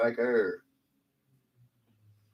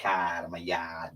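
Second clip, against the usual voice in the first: imitated Boston accent; 5 semitones lower, 6 dB quieter than usual. Co-host echoes the Boston accent.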